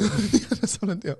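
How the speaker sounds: high, put-on voice echo; mock echo. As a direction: repeat the deal line in a put-on voice. Echoing the deal line.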